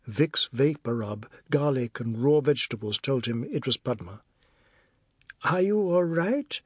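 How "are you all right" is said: high rising question; concerned woman. A narrator's reading. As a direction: check on him with concern. Woman checks on beaten man.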